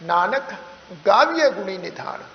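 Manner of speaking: "Nanak" with a rise, then a clear pause before "ਗਾਵੀਏ"; pause after Nanak. Shown as a demonstration. Teacher shows the class the pause after "Nanak". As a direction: hold "Nanak" and let it hang before the rest. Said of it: "ਵਿਸ਼ਰਾਮ ਦੇ ਕੇ ਹੀ ਅੱਗੇ ਤੁਰਾਂਗੇ".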